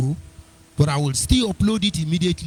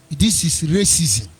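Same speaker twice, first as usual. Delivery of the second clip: normal talking voice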